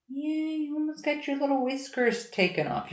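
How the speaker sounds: high, soft cooing pet voice. Real, not acted. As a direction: coo the words softly at the cat. Cooing at the cat.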